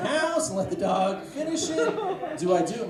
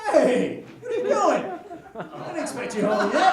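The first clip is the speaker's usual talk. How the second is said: high, loud, cheerful dog; 11 semitones higher, 6 dB louder than usual. Acted. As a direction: play the dog greeting its owner. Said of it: "It's just like".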